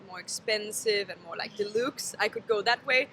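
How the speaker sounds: usual speaking voice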